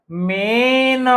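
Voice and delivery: मेन with a long held ए sound; drawn-out pronunciation. Shown as a demonstration. Teacher sounding out a new word, vowel held long. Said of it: "ये उच्चारण जब भी".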